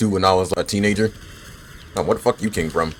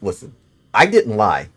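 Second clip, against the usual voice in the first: ordinary talk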